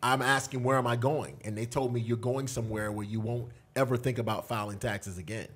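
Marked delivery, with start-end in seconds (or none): none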